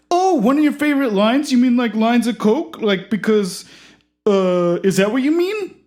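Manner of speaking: high eager host voice. Acted. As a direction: usher the guest in brightly.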